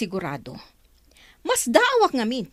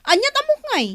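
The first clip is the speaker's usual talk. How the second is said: indignant; 12 semitones higher than usual. Acted.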